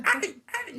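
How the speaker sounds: very high mock yelps; imitando los quejidos. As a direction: squeal in mimicked pain. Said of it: "Decía". (chillidos de dolor imitados)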